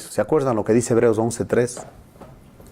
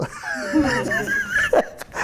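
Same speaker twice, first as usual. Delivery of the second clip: high mocking laugh. Mocking laugh after dialogue.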